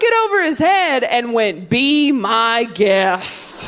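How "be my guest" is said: drawn out very slowly; dead calm, slow menace; slow deadpan. Retold or imitated. Dad with knife.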